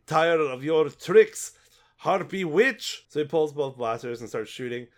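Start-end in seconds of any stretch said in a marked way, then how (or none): 0.1-3.1 slow furious shout at the witch; angry shout. Acted. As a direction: spit out the insult, slowly. Character confronting witch.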